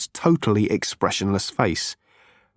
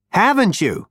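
higher, brash Zaphod voice; 8 semitones higher than usual. A narrator's reading.

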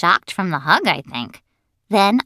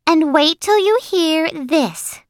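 high, drawn-out, gushing schoolgirl; high-pitched and dramatic, stretching it out; excited bragging girl; 10 semitones higher, 3 dB louder, slower than usual. Acted. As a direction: build suspense like a show-off kid.

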